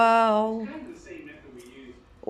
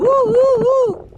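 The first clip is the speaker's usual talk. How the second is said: joyful whoops; 8 dB louder than usual. Real, not acted. Cheering the bird flying.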